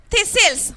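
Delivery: "thistles" said as this-ils, no T in the middle; silent T. Shown as a demonstration. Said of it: "I pronounce as thistles".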